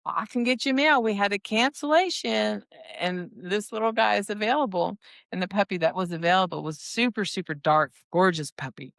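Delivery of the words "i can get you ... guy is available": playful teasing offer. Retold or imitated. Breeder teasing Art about a spare male puppy, playful and higher. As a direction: tease him like you're dangling a treat. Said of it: "I was like".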